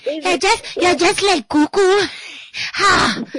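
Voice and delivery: put-on high childlike voice of a son accusing mom; imitating her son. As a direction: pitch way up to mimic the boy's complaint. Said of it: "grievances that my son brought up is".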